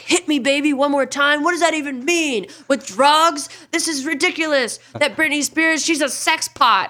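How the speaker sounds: high, loud outraged rant; freaked-out uncle. Retold or imitated. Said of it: "my uncle just freaked out".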